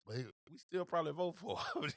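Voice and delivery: normal talking voice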